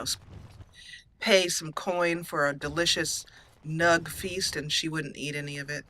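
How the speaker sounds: ordinary delivery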